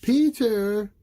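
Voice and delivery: high, loud call of a name. A narrator's reading. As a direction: call him back from the door. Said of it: "his mother called out".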